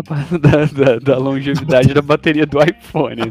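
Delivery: teasing with a laugh. Real, not acted. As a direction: laugh through the joke. Laughing jab at iPhone battery.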